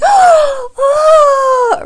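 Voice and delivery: huge high shouted "Oh" of delight; thrilled gasp. Retold or imitated. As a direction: squeal it like you just got a Ferrari. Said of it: "I would be like".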